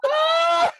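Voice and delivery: high, loud imitated shriek of pain; imitated scream. Telling friends how the girl screamed, a high imitated shriek.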